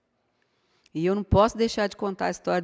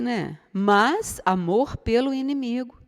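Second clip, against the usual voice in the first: normal talking voice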